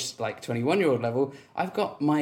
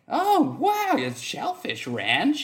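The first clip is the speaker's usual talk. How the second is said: high swooping wow, then drawn out; mock amazement; 10 semitones higher, much slower than usual. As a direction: imitate a wildly impressed date. Imitating the impressed woman.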